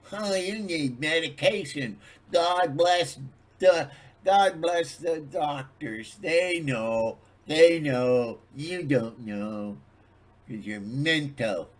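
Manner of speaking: high, loud, slow preachy mockery; mock a know-it-all skeptic; sarcastic patronizing voice; mock condescending voice. Mocking people who doubt him.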